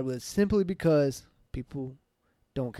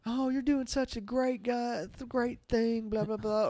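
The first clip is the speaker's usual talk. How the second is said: higher, slow, syrupy mock praise; hammed-up cooing over someone's good deed; mock gushing praise; 8 semitones higher, 3 dB quieter, slower than usual. Friend mocking the praise posts people would leave, sugary and slow.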